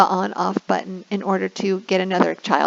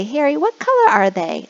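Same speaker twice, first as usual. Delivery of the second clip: kindergarten teacher voice; 6 semitones higher, 4 dB louder than usual. Shown as a demonstration. Asking child.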